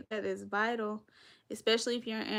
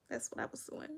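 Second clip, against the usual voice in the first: whispered; 10 dB quieter, faster than usual. Real, not acted. Whispered aside.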